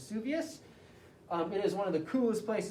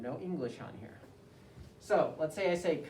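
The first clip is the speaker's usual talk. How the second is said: normal talking voice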